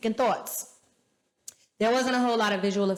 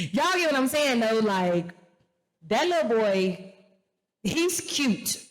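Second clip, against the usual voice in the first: ordinary talk, nothing marked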